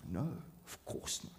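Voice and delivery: almost whisper it, gently certain; whispered reassurance. Real, not acted. Preacher reassuring anxious Christians, near whispered. Answers his own rhetorical question.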